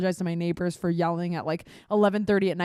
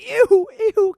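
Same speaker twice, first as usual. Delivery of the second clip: higher, louder grossed-out squeal; disgusted ew; 11 semitones higher, 9 dB louder than usual. Retold or imitated. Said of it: "I'm like".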